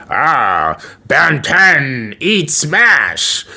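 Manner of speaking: hammed-up zombie voice; zombie Ben. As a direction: grunt it like a crazed zombie.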